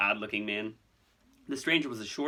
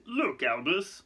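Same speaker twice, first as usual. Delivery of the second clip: higher, uneasy appeal; uncomfortable; 6 semitones higher, much slower than usual. Acted. Fudge objecting to Dumbledore, uncomfortable. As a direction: start an awkward objection. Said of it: "said Fudge uncomfortably".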